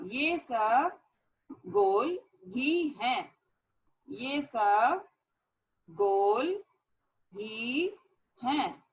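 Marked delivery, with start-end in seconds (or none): 4.1-8.8 ये सब गोल ही हैं with a pause after each word; slow word-by-word dictation. Shown as a demonstration. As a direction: spell it out one word at a time for students to copy. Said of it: "teacher dictates word by word". (धीरे-धीरे, हर शब्द अलग बोलकर)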